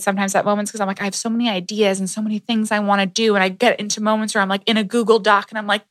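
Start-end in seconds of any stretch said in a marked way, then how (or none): none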